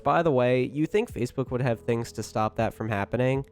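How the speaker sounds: usual speaking voice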